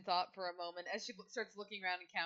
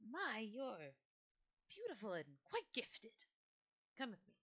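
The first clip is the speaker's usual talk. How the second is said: put-on old lady; 4 semitones higher than usual. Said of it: "As the old lady looks out there and goes".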